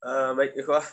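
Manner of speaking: ordinary talk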